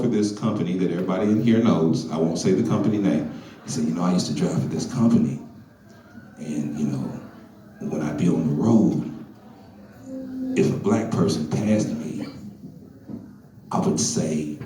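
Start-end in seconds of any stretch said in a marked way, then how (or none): 3.8-14.6 lightly voiced caller, low and hushed. Caller phoning Lason with a confession, low and hushed. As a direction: voice dropped much lower and softer for the caller.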